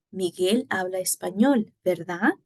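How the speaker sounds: statement, then VERDAD rising as a question; tag-question intonation. Shown as a demonstration. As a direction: lift only the final "verdad" to check a fact. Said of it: "intonation on just".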